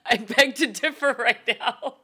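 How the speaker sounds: high, slow words squeezed through laughter; real laughing fit around the words; helpless laughter. Real, not acted. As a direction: disagree while cracking up. Laughing hard while disagreeing.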